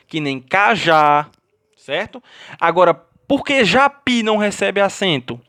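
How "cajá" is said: slow "cajá", weight on the final JÁ; last-syllable stress. Shown as a demonstration. Teacher giving students an oxytone example, stress on final JÁ of "cajá". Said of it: "com um acento na última sílaba".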